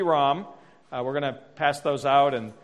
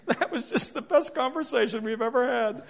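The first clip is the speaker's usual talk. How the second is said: high, mock-weepy gushing; imitated tearful wife; 8 semitones higher than usual. Crying wife praises the talk to husband, hammed-up tearful. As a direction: play the wife overcome, voice pitched up. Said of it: "she's just sitting there crying".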